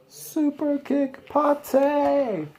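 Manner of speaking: very high, slow drawn-out move call; wrestling move call. Acted. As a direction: stretch it out like an announcer calling a move. Shouting the wrestling move name.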